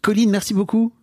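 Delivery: high-pitched pretend podcast outro; mock sign-off. Fake sign-off after refused accent.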